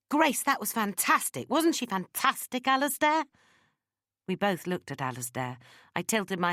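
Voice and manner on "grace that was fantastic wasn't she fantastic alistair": high, excited gushing praise; gushing fan. Acted. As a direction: gush like their biggest fan, pitched way up. Biggest fan gushes over her singing.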